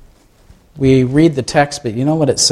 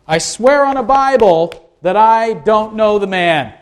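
swearing an oath; 5 semitones higher than usual. Acted. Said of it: "Peter adds an oath".

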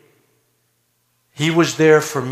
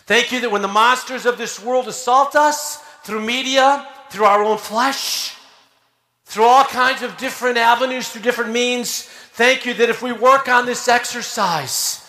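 impassioned prayer; 8 semitones higher than usual. Acted. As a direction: lift the prayer with fervour. Prayer builds, preaching.

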